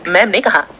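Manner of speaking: usual speaking voice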